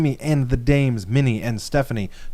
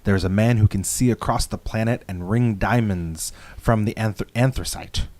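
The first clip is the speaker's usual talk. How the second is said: low, hushed literary reading; dramatic reading; 4 semitones lower than usual. Shown as a demonstration. Host reading a favorite Alan Moore passage, hushed. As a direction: read it like a reverent passage. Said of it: "I wanted to read one of my favorite lines".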